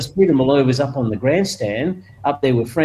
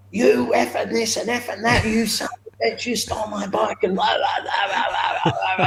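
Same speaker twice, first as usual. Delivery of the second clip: higher, half-laughing angry tirade; mock furious rant; 8 semitones higher, 4 dB quieter than usual. Limping owner abusing the rider who stole his bike, mock furious. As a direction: tear into him, hammed-up.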